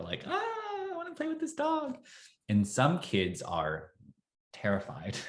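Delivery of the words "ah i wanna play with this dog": high, quick squeal of delight, imitated; excited kid. Kids spotting the speaker's dog, excited. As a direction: mimic a kid bursting to play. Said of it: "they're so excited".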